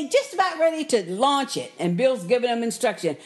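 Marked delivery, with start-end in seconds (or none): none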